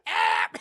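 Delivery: short loud yelp of fright; hammed-up scream; mock scream. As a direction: yelp as the gun comes out. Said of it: "He's just screaming".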